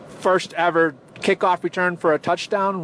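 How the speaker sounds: ordinary talk, nothing marked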